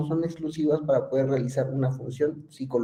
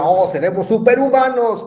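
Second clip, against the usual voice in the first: higher and louder, a hammed-up gasp of wonder; mock amazement; 8 semitones higher, 9 dB louder than usual. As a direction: play the awed moviegoer, half joking. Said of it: "Y diría".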